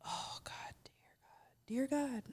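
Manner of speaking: hushed, pained whisper; exasperated whisper. Real, not acted. Remembering her ex's snoring, she groans in an exasperated whisper. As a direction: whisper it like you can't bear the memory.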